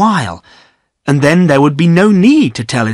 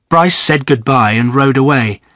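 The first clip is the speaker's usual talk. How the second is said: ordinary delivery, nothing marked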